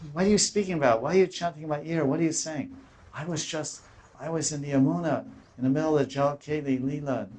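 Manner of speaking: high, fast bewildered complaint; Chaitanya's protest. Retold or imitated. Chaitanya to Damodar and Ramananda, high fast bewildered protest. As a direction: demand why they woke him, high and quick.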